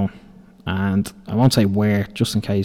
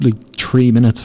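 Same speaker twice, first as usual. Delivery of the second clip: normal talking voice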